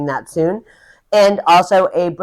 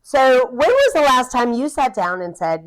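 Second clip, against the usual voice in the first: ordinary talk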